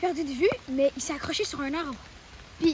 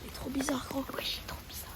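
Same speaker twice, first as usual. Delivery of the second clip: hushed whisper behind his back; 8 dB quieter than usual. Real, not acted. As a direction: whisper so he can't hear. Friends whisper about stranger.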